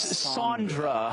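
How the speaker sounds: slow Sandra with an open AH, SAHN-dra. Shown as a demonstration. Host correcting a guest on his co-host's name, slow and deliberate. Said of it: "It is Sandra".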